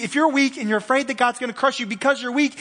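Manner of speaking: usual speaking voice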